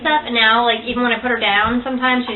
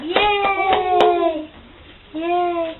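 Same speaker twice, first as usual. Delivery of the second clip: put-on baby's cheer; 6 semitones higher than usual.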